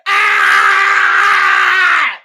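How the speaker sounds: loud sarcastic yell; mock yell. Mocking the commenter, loud fake yell. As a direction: let out a fake angry yell.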